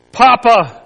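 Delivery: loud anguished call; crying out. Acted. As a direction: call out to a father who won't answer. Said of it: "Jesus cried".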